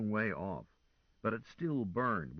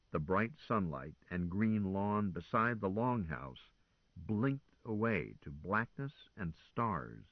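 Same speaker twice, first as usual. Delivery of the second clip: plain reading voice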